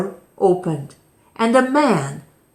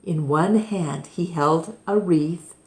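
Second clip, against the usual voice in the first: ordinary delivery, nothing marked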